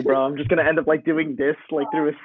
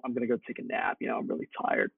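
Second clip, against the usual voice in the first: sleepy mumble; 3 semitones lower, 9 dB quieter, faster than usual. Retold or imitated. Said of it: "and I told him".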